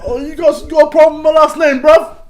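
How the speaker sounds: high, loud laughing fake Cockney; hammed-up Cockney Honeyball; mock Cockney. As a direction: challenge them like a London tough. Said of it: "Oi, governor".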